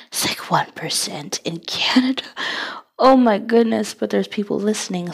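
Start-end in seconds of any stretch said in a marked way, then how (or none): 0.1-2.2 whispered aside; whispering. Real, not acted. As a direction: tell it as a whispered secret.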